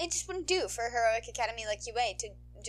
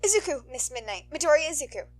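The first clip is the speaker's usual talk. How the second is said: put-on higher, bright thankful kid voice; grateful boy; 7 semitones higher, 11 dB louder than usual. Boy thanking the heroine, grateful. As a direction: thank her sincerely.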